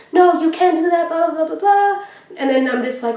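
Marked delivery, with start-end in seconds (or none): 0.1-2.0 higher, mocking voice of the bossy guard lady; guard lady chasing them down, mock-whiny; mocking the security lady. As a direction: parody the security guard's nagging. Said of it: "comes running down saying".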